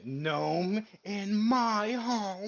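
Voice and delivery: long hammed-up battle cry; screaming. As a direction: scream it out, stretching every word. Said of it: "and just screaming".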